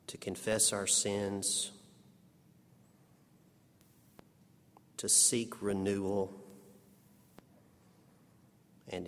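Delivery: hushed, unhurried pastoral prayer; spoken prayer. Acted. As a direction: pray gently for the whole room. Leads the congregation in prayer.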